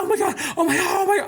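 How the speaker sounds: very high mock cries of pleasure; over-exaggerated moaning imitation. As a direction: squeal it rapidly in a mocking falsetto. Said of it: "she over exaggerated ... and she was like".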